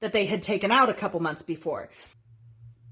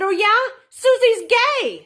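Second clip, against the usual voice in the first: much higher, louder panicked blurt; panicked retort; 11 semitones higher, 6 dB louder than usual. Retold or imitated. Cornered sister blurting a secret back in panic. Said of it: "So I panicked".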